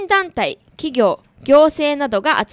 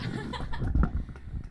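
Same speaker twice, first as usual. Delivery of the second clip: breathy burst of laughter; 10 semitones higher, 9 dB quieter than usual. Real, not acted. Laughs at being teased off-air.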